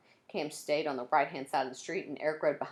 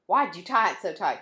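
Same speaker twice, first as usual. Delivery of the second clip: louder, calling to another room; a raised call into the house; 3 semitones higher, 10 dB louder than usual. A narrator's reading. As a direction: call out to someone inside. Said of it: "he called into the house".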